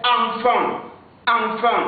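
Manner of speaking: enfant said slowly, nasal a, no final t; correct pronunciation. Shown as a demonstration. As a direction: model enfant clearly for learners. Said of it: "la vraie prononciation du mot".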